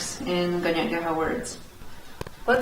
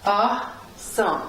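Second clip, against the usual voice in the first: "Awesome" split in two with a long gap; teacher's slow, separated "Awesome"; syllable by syllable; slower than usual. Shown as a demonstration. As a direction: sound out "Awesome" slowly as Awe, some. Teacher sounds out the word.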